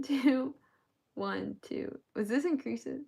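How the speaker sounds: usual speaking voice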